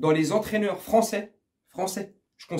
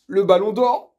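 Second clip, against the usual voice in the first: sudden shout; 3 semitones higher, 8 dB louder than usual. Pretend. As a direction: blurt it out to wake the baby. Sudden loud joke after hushing. (crié d'un coup)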